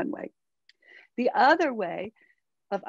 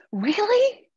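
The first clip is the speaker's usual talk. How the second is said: much higher, loud thrilled question; excited daughter; 12 semitones higher, 6 dB louder than usual. Retold or imitated. Daughter hearing mom will give money, thrilled and loud. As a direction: squeal it in disbelief. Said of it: "she was excited".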